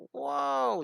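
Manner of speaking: high, drawn-out startled whoa; mock freaked-out. Drawing teacher voicing the jumping cat, high mock-startled cry. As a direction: play a cat jumping in fright, without shouting. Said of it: "he's, like, really freaking out".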